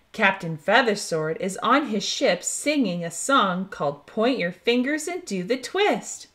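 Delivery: usual speaking voice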